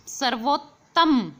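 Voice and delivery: सर्वोत्तम stretched slowly, half t made clear; half t sound shown. Shown as a demonstration. Teacher spells out सर्वोत्तम slowly for students. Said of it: "आधे तय की आवाज़ और आनी है".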